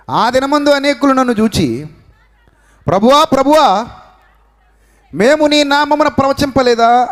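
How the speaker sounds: high, ringing pulpit reading; preacher reading the Gospel aloud to the faithful, high and ringing; fervent scripture reading. Acted. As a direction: proclaim the verse to the congregation.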